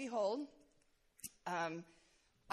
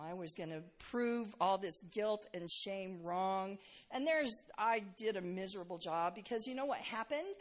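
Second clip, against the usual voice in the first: ordinary talk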